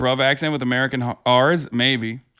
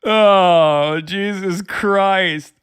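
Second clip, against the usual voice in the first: long drawn-out oh, then a slow Jesus Christ; real disbelief, stretched out; louder, slow, drawn-out exasperation; exasperated groan; 5 dB louder, much slower than usual. Real, not acted. Reacting to the clip.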